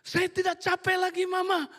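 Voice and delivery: high, loud joyful shout; child shouting. Retold or imitated. Healed girl shouting to her mother, high and joyful. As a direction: call out to mama with glee. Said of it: "dia teriak".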